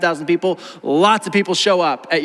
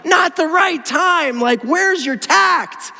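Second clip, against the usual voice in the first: drawn-out, higher-pitched disbelief; exasperated rebuke; 8 semitones higher, much slower than usual. Acted. Preacher scolding an imagined interrupter for bad timing, exasperated. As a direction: stretch the words in mock exasperation.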